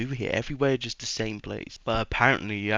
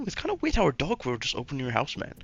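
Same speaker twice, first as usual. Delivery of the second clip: higher-pitched put-on American accent; 5 semitones higher than usual. Ram hinting at what he knows, in put-on American accent. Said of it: "I'm doing an American accent".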